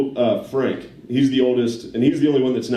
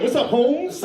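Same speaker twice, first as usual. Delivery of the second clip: higher, laughing imitation of seventies jive talk; seventies street slang; 12 semitones higher than usual. Uncle greeting his nephew, laughing imitation of seventies jive.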